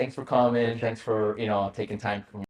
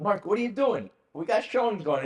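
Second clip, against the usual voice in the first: higher, louder, incredulous call; hammed-up disbelief at a partner; exasperated; 11 semitones higher, 5 dB louder than usual. Host calling out Mark during showings, exasperated and higher. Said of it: "I'm like".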